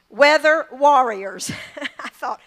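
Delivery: usual speaking voice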